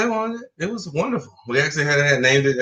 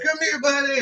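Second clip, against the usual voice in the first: high coaxing dog voice; 12 semitones higher than usual. Retold or imitated. Voicing the dog.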